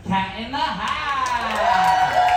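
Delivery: drawn-out winner announcement, rising; announcer call. Acted.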